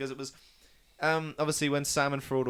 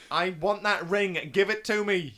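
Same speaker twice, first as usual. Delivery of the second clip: raised, louder acted demand; mock-insistent cry, pitched up; greedy demand; 6 semitones higher, 7 dB louder than usual. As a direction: demand it loudly for yourself. Acting out Gollum's demand.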